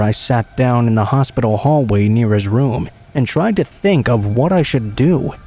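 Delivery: ordinary delivery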